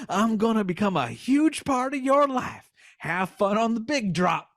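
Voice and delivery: high Southern twang; Southern fox twang. Acted. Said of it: "twang for the voice of the fox".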